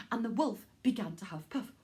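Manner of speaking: ordinary delivery, nothing marked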